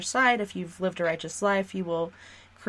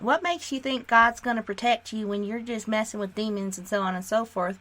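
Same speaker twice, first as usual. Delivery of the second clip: put-on southern accent; 3 semitones higher than usual. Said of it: "I'll go into my southern accent".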